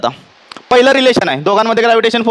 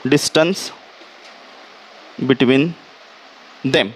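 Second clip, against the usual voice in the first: DISTANCE, long pause, BETWEEN, long pause, THEM, kept low; word-by-word dictation; 8 semitones lower, 4 dB quieter, much slower than usual. Shown as a demonstration.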